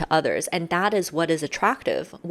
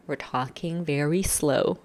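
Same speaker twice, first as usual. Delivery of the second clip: slow, drawn-out words; talking slow; 3 dB quieter, slower than usual. Shown as a demonstration. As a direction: stretch each word out slowly. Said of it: "things like fast and slow".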